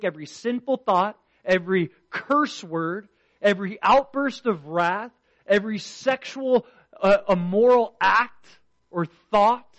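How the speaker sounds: preacher hammering home every sin to the congregation, loud and high; preaching at full force. Acted. Preacher pressing the point to his church, full-force preaching.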